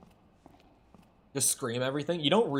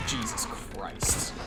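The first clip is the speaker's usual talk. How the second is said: exasperated whisper; 6 semitones lower, 5 dB quieter, much slower than usual. Real, not acted. Exasperated at game puzzle.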